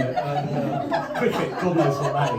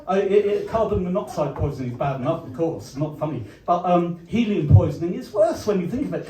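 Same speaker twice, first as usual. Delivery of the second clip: ordinary talk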